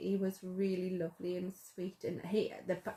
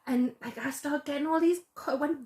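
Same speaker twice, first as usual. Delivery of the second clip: excited retelling, pitched higher, in a loose put-on London voice; imitating his accent; 6 semitones higher than usual. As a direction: tell it the way he told it, excited. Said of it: "I can't do the accent".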